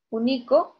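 "único" said as u-NI-co; stress on middle syllable. Shown as a demonstration. Teacher offering a second option for "único", middle-syllable stress. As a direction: wrongly put the weight of "único" on NI. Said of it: "la mayor fuerza de voz".

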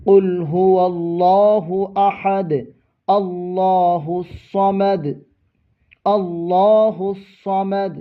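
slow, melodic recitation of the verse; Quran recitation. Shown as a demonstration. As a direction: draw out each word in tajweed. (ধীর সুরেলা তিলাওয়াত)

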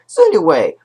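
ordinary talk, nothing marked

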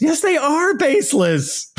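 a high, slowed, mocking yes they are baseless; emphatic rebuttal. Real, not acted.

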